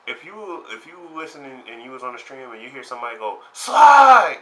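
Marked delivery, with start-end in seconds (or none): 3.5-4.4 high, loud yell of "Slide!"; shout. Retold or imitated. Player yelling at the stream, high and loud. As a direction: yell the move at the screen. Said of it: "that was me".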